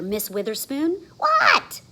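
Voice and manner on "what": high squeaky what; startled. Acted. Froggy startled by teacher, high squeak. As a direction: jump in surprise.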